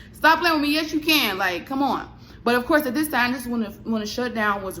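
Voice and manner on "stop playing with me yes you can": higher, louder mock comeback; insistent retort. Talking back to the landlady, loud and high insistence. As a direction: fire it back sharply, pitch up.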